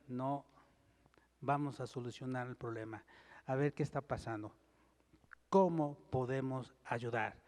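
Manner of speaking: soft, reassuring answer to family; gentle reply. Shown as a demonstration. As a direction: speak it as a patient peacemaker. Modelling the right gentle answer.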